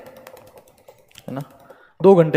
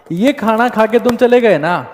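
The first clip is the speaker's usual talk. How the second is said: ordinary talk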